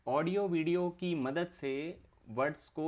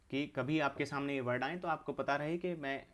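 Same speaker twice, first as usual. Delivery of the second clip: ordinary delivery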